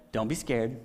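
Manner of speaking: calm the frightened priest gently; soft reassuring angel. Retold or imitated. Angel voiced softly.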